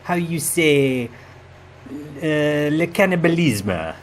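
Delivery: mock-dramatic reveal in a hammed-up French accent; a sly French-accented confession; host reveals he is from another universe, in mock French; fake French accent. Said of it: "outrageous accent".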